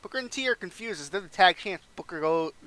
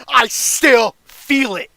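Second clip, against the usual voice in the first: much louder and higher, slow, fervent; impassioned cry; 7 semitones higher, 13 dB louder, much slower than usual. Pretend. Foley telling Randy he felt it, impassioned. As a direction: pour everything into it.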